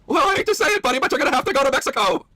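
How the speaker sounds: very high put-on manager voice; store manager. Manager to customer about Mexico, high put-on voice. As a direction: break bad news in a silly high voice. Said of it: "I'll be the manager".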